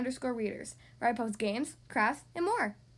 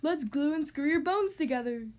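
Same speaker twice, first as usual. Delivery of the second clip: Frankenstein voice. Acted. Frankie Stein suggestion.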